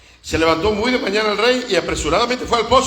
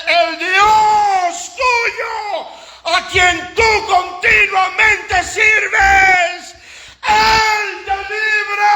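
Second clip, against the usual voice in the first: very high, slow shouted blessing; preacher's full-force cry as the king; impassioned cry; 12 semitones higher, 4 dB louder, much slower than usual. Acted. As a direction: wail it out long and high, every word stretched. King's words cried out to Daniel.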